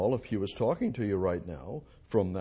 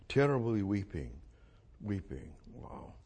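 trailing off into near silence; fading hush; 3 semitones lower, 6 dB quieter than usual. Real, not acted. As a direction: let the words die away softly. Fading awe at Jesus' tears.

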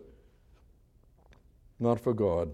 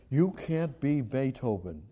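usual speaking voice

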